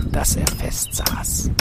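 hushed, voiceless whisper. A narrator's reading. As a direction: breathe the words out in a whisper. Nail driven in, narrator hushes.

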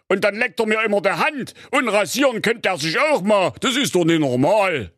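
put-on a puzzled old king raising a complaint. A narrator's reading. King complains about the dog.

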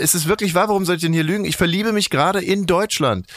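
high, bright insisting; insistent cheer. Acted. Host defends his new love for the country, high insistent cheer. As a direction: keep up the put-on enthusiasm.

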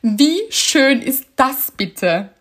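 slow, delighted through laughter; laughing delight. Retold or imitated. Retelling her own laughing reply to the official, slowly. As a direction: savour each word, amused. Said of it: "ich habe gelacht und habe gesagt".

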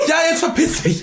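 high, heated brush-off; angry dismissal. Acted. Prisoner quarrelling with cellmate, angry dismissal. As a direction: chase the cellmate off, hammed-up anger.

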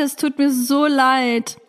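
ordinary talk

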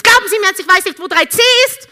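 loud, high, fast sneering retort; rude passenger. Retold or imitated. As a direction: bark it arrogantly.

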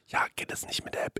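toneless whisper. Retold or imitated. Waitress asking about the app, whispered. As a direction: ask it quietly under the film.

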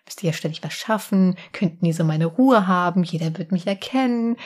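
usual speaking voice

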